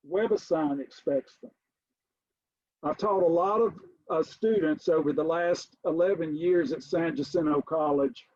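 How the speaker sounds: usual speaking voice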